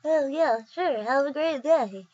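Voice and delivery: put-on character voice with swooping pitch; Rite Aid guy impression. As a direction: give the sign-off in the store guy's goofy voice. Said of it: "I love that guy's voice so much".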